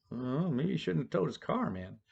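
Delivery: hushed, reluctant remark; hesitant aside. Retold or imitated. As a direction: imitate a bystander quietly second-guessing a colleague. Other cop's doubtful aside, acted.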